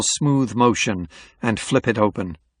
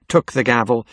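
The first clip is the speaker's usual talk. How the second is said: ordinary delivery, nothing marked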